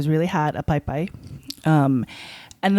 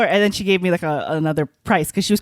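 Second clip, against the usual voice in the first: ordinary talk, nothing marked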